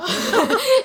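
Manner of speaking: loud, high laughing burst; high burst of laughter. Real, not acted. Laughing at the awkward classroom scene.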